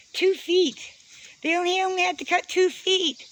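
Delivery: high-pitched exasperation at the neighbors; exasperated. Real, not acted. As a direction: hammer home how little they had to cut. Indignant rant about tree cutting.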